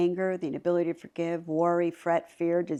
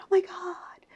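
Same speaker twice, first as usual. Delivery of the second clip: shocked gasp; 11 semitones higher than usual. Retold or imitated. Husband reports the reporter died.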